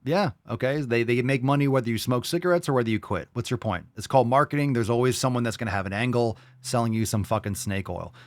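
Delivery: ordinary talk, nothing marked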